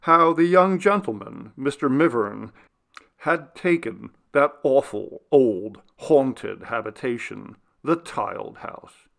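plain reading voice

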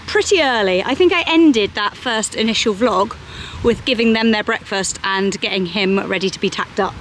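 ordinary delivery

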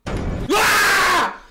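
high piercing shriek of fright; startled scream. Real, not acted. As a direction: scream as it jumps out. Jump scare.

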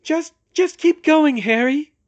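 high, loud, faltering urging; shaky. A narrator's reading. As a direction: urge on shakily, stumbling at the start. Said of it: "she said shakily".

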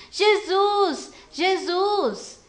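loud, high repeated call; shouting. Acted. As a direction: keep calling out for him at full voice. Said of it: "E ele ficou gritando".

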